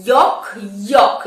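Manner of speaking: yolk as "yok", L silent; correct pronunciation. Shown as a demonstration. Teacher introducing word number four, correct pronunciation. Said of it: "একে আমরা কি বলবো?".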